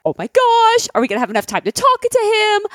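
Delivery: high-pitched, rushing retold panic; freaking out. Host fretting over too little interview time, freaking out. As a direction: act out inner panic, pitch way up. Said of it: "I'm freaking out 'cause I'm like".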